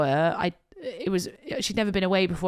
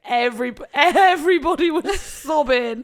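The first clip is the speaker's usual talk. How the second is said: high, loud, slowing into a laugh; tearful; 5 semitones higher, 4 dB louder than usual. Real, not acted. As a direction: insist through laughter and tears. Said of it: "I'm still crying".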